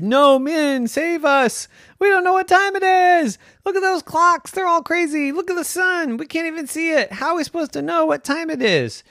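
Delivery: high, hammed-up panicky begging; panicked pleading. The school crowd begging Min to save them, high panic. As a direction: beg for rescue in a squeaky panic.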